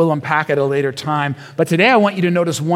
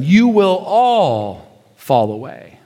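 slow solemn quote; much slower than usual. Acted. First quote of Jesus.

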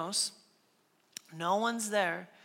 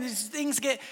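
ordinary talk

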